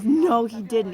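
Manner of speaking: loud, incredulous acted reaction; shocked disbelief. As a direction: imitate a friend who can't believe it. Friend's retold shocked reaction.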